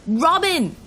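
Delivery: high, loud cry of a name; desperate shout. A narrator's reading. Driver shouts over the officer, desperate. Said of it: "I shouted over him".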